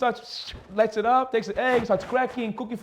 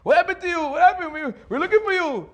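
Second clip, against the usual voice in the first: high, loud exclaiming; surprised vendors; 9 semitones higher, 4 dB louder than usual. Retold or imitated. Vendors at his door asking where he was, high and loud. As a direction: burst out at finally finding him. Vendors find the missing buyer.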